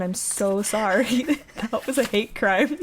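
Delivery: amused at your own awful attempt; laughing apology. Real, not acted. Laughing apology for terrible accent.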